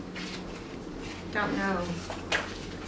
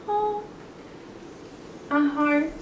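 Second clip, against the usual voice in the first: softer, high aww of sweetness; touched; 4 semitones higher, 8 dB quieter than usual. A narrator's reading. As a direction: melt at the cute hug. Narrator touched by the hug.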